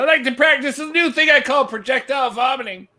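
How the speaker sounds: high-pitched comedian impression; put-on high comic voice; Bobcat Goldthwait. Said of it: "He's like".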